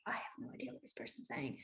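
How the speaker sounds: rushed, barely voiced inner thought; fast mock whisper. Signer confused by a stranger's question, fast whisper. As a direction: whisper it quickly, lost and confused. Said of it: "you stare at them like".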